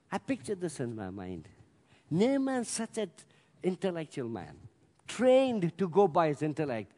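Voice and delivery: usual speaking voice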